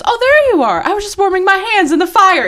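very high, loud eerie sing-song; creepy sweet girl voice. Retold or imitated. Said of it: "she goes".

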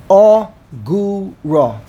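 agura slowly split as a, gu, ra; syllable by syllable. Shown as a demonstration. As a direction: give each of the three beats of agura its own space. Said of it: "three syllables".

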